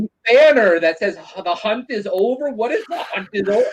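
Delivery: high-pitched incredulous mimicry; hammed-up bafflement, high voice; mock outrage. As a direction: imitate a scandalized friend questioning every item. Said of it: "You're like".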